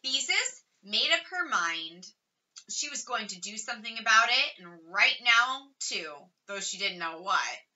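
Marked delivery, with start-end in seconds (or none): none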